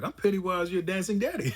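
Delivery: high-pitched playful clown imitation; sing-song it lightly as a creepy clown; Pennywise. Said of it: "He's like".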